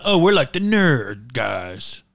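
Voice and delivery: slow, drawn-out mock nerd. Mocking Vans wearers to a friend, nerd voice. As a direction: put on a dopey nerd voice, dragging it out. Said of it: "that's your nerd voice?".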